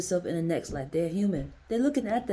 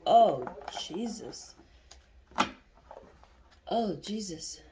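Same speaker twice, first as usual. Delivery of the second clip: retold crying; 3 dB louder, much slower than usual. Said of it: "I was like".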